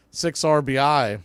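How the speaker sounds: normal talking voice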